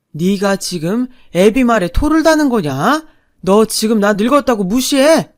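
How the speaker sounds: loud, indignant father's outburst; worked-up anger. A narrator's reading. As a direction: roar at your son for talking back. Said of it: "시아버지는 감정이 격해지셔서는".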